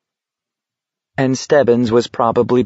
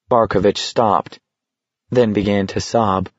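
ordinary delivery

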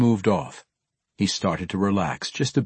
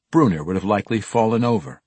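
ordinary delivery

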